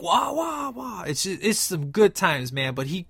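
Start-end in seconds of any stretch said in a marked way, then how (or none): 0.0-1.0 high, strained mock wail; mad-face wail. Imitating Leroy's wide-mouthed mad face with a wail. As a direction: imitate his wide-mouthed crazy yell. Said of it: "with his mouth wide open when he's like".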